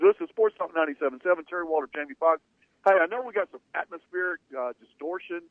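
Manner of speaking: usual speaking voice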